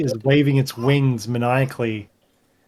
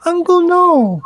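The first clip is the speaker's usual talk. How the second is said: put-on much higher, loud little girl cry; squeaky child; 6 dB louder than usual. Girl crying out to her uncle, high and loud. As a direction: shriek it like a small girl. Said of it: "And she's like".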